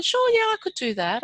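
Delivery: high, bright-eyed yes to any job; young banker keen to take any job; eager agreeing. Retold or imitated. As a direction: say it keenly, voice jumping up.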